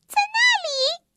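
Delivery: very high-pitched little elf; tiny elf voice. A narrator's reading. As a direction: point it out in a tiny, sky-high voice. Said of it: "细小的声音".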